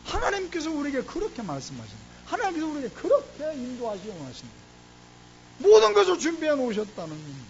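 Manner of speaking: a pastor's fervent sermon climax; full-force preaching. Real, not acted. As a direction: drive it home to the congregation like a revival preacher.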